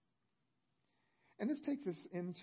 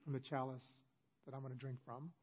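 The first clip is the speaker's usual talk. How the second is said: sadly; 4 semitones lower, 6 dB quieter than usual. Retold or imitated. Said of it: "looks at them very sadly and says".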